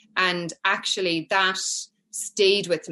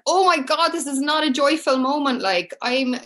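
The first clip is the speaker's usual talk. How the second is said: raised pitch, mildly indignant retelling; cross, exasperated; 7 semitones higher, 4 dB louder than usual. Retold or imitated. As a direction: re-enact being upset that mum misreads the moment. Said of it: "I was so cross with her".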